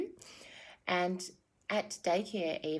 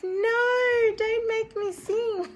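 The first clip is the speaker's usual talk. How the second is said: much higher, louder mock whine; hammed-up refusal to her spirit guides; playful protest; 8 dB louder, slower than usual. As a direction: squeal like a reluctant kid. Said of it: "And I'm like".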